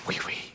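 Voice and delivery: oui, oui said on an in-breath, very soft; inhaled oui oui. Shown as a demonstration. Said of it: "They'd go, ... it was a breathing in".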